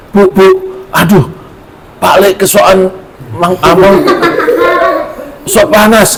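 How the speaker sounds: put-on high, frantic child's voice calling his mother; a boy crying out in alarm, high; panicked child. Panicked nephew runs to mother.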